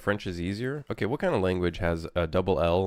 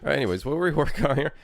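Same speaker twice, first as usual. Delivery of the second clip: laughing; 6 semitones higher, 4 dB louder, much faster than usual. Real, not acted. Laughing back to work.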